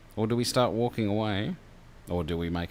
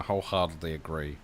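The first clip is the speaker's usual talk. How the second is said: usual speaking voice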